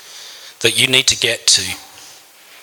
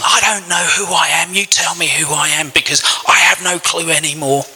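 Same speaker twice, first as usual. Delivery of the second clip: higher, pleading cry to God; desperate pleading; 6 semitones higher than usual. Retold or imitated. Man retelling his plea to God, higher and pleading. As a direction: beg to be told who you are.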